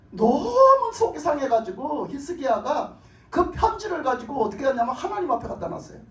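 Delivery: normal talking voice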